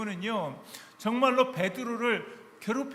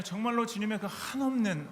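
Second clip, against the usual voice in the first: usual speaking voice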